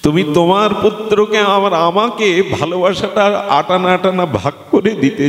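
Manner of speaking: high-pitched declamation for a devoted crowd; God's demand as a high, ringing sermon; impassioned preaching. Acted.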